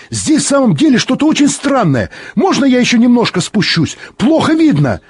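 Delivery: very high, agitated plea; excited pleading. A narrator's reading. Pilot begs his friend to let him descend, excited.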